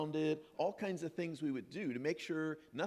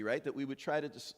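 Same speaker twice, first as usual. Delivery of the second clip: ordinary delivery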